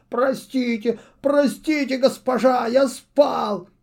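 high, desperate owl cry; panicked owl. A narrator's reading. Owl confesses sleeping to his witch mistress, panicked. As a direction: beg for mercy in panic.

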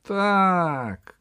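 high, drawn-out call; young voice shouting. A narrator's reading. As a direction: shout agreement from out in the square. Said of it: "крикнул с площади молодой голос". (звонкий выкрик с площади)

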